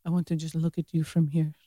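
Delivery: very soft imitation of a suitor; retold romantic murmur. Man to woman at the bedroom door, imitated soft romantic line. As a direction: drop right down, hushed and corny. Retells his corny romantic reply.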